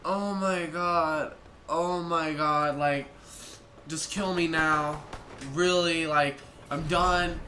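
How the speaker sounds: slow, drawn-out despair; wearily melodramatic, very slow; mortified exasperation. Retold or imitated. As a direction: drag it out as if completely done. Acts out mortified, fed-up reaction.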